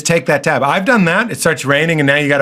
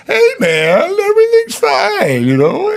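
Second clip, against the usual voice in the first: much higher, drawn-out laid-back California dude; California chill; slower than usual. Retold or imitated. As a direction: float it out like a mellow surfer. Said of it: "not a way to talk anywhere but here".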